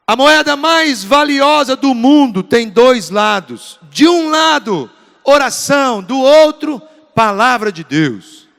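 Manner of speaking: loud, emphatic sermon delivery; forceful preaching. Real, not acted. Preacher urging the church to seek God's will, full-force preaching. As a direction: proclaim it to the whole church, punching key words.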